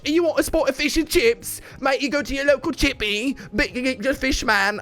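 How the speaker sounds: put-on high-pitched, over-the-top British accent; hammed-up caricature of British chippy talk; mock British accent. Teasing British viewers about fish and chips, in an exaggerated British accent. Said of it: "that's not how you speak".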